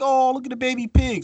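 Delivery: high, cooing mock girly voice; cooing girls. Starving contestants cooing at a piglet, mock high coo. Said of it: "but they're like".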